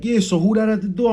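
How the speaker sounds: a grandma asking who came in; grandmother calling. Retold or imitated.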